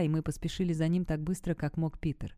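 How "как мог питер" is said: plain reading voice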